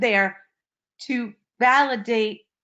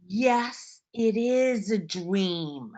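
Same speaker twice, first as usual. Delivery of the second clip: slow, softer and tender, like soothing a lost man; gentle soft affirmation; 2 semitones lower, 5 dB quieter, much slower than usual. Retold or imitated. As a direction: quietly reassure him, very slowly. Telling the stranger gently.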